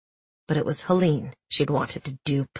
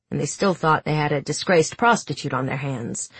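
plain reading voice

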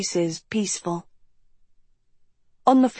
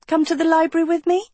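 put-on bright, quick, high girl's voice; light and eager, higher and fast; Sophie's voice; 9 semitones higher, much faster than usual. A narrator's reading. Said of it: "Sophie smiled".

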